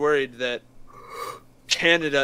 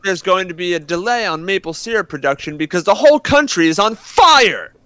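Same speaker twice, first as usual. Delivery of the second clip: loud, higher news-anchor read; loud announcer delivery; 5 semitones higher, 10 dB louder than usual. Retold or imitated. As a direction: bellow the headline like a TV announcer.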